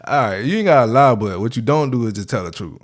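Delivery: ordinary delivery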